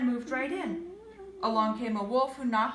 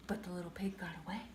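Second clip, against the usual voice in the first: fast unvoiced whisper; 7 semitones lower, 10 dB quieter, much faster than usual. A narrator's reading. Narrator whispering the pig's escape to the listener. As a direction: hurry it out in a hush.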